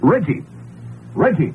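sharp high call for someone. Acted. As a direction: shout the name into the night. Calling for guard in the dark.

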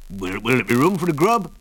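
put-on wheezy old prospector voice; wheezy old man. A narrator's reading. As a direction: rasp it out like a wheezy old miner. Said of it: "in a wheezy voice".

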